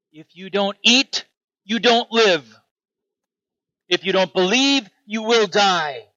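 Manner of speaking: impassioned sermon warning; forceful preaching. Acted. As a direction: drive each warning home from the pulpit. Preaching Jesus' words at full force.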